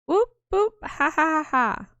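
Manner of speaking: put-on playful baby noises. Playing with the baby.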